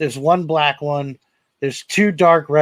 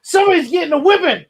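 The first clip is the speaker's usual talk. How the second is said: much higher, fast playful warning; calling out; 10 semitones higher, 4 dB louder than usual. Real, not acted. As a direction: holler it across the yard. Yelling at chickens offscreen.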